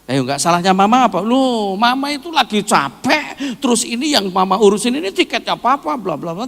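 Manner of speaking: high, loud, indignant back-and-forth; arguing couple. Retold or imitated. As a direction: act out a spouse's heated excuses. Acted reopened marital quarrel.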